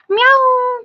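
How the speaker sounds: very high, held meow; playful cat meow. Pretend. Imitates cat to pet.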